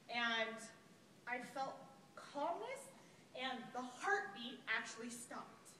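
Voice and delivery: ordinary delivery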